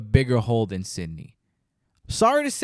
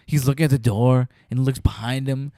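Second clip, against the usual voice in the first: ordinary delivery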